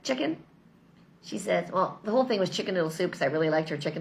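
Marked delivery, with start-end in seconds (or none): 0.0-0.4 lightly voiced grandmother, pitched higher; imitated grandmother. Grandmother calling grandchild by nickname, lightly imitated higher voice. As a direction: call out a pet name as grandmother would. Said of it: "She'd be like".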